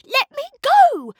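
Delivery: very high, loud struggling yell; shrieking shout. A narrator's reading. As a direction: tear free from his grip. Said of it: "As Cindy shouted".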